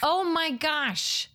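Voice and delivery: slow, drawn-out gasp of surprise; mock surprise. Real, not acted. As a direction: gasp it out slowly, faking shock. Reaction to shaved beard.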